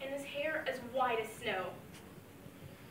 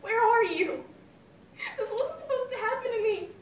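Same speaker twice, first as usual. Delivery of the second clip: sobbing; 10 semitones higher, 10 dB louder than usual. Acted. Daughter breaks down at grave.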